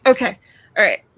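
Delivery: usual speaking voice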